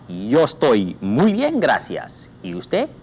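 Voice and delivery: slow careful answer to repeat; slow model answer. Shown as a demonstration. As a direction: spell out Yo estoy muy bien slowly for the class. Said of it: "repítala, repita".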